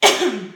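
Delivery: sore-throat cough; loud hacking cough. Real, not acted. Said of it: "j'ai une angine".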